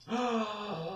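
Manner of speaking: soft drawn-out gasp; mock astonished intake; shocked gasp. Richard hearing Nathan's surname, drawn-out shocked gasp.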